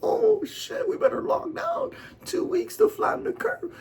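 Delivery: shrieking falsetto mock panic; panicked crowd. Mocking the panicking world in a shrill falsetto. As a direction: squeal it like terrified sheep. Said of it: "and they're like".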